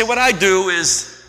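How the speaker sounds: normal talking voice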